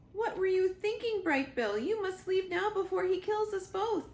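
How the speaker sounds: a robot mother's urgent plea, pitched high; high, tense mother robot; worried robot mother. Acted. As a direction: hurry her son away, afraid for both of them. Roz voiced in read-aloud story.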